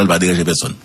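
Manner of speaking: normal talking voice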